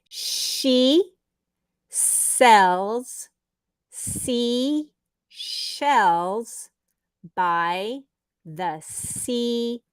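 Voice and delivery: each word said slowly, well spaced; slow and deliberate, word by word; slow tongue twister demo. Shown as a demonstration. Teacher showing a student a tongue twister slowly. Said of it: "Okay, here we go. Are you ready?".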